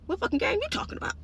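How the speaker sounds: high, quick, baffled mock question; incredulous. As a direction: squeak it out fast in mock disbelief. Acted baffled reply to boss.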